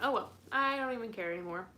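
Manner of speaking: ordinary talk, nothing marked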